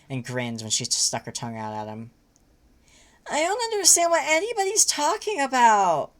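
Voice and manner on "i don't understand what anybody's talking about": much higher wailing voice. Acted. Nepeta wailing in confusion at everyone. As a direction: wail it in despair. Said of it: "wailed Nepeta".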